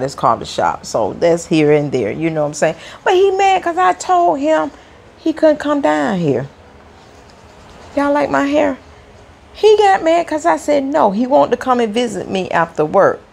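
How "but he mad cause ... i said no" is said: excited. Real, not acted. Excited venting to viewers.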